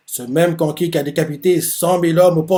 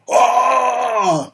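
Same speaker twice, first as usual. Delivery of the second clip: army roar; 8 semitones higher than usual. Retold or imitated. Soldiers answering the order, roaring. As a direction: roar back as one. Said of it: "Et ils font".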